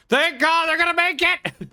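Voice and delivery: high, loud relieved cheer; excited cheer. Real, not acted. Streamer cheering his ships arriving in time, excited. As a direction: cheer them home, pitch way up.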